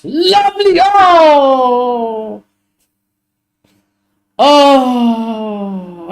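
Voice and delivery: big drawn-out groan at a near miss; anguished fan cry. Real, not acted. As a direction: wail it out, falling away. Watching match, reacting to missed chance.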